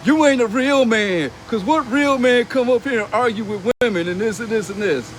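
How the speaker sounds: mocking a woman who lectures him on being a real man; mocking her lecture. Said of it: "she was one of those".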